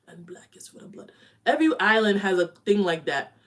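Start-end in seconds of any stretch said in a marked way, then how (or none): 0.1-1.1 hushed ominous whisper; mock solemn whispered finale; dramatic whisper. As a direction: whisper the last line for effect. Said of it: "be like the West Indies now".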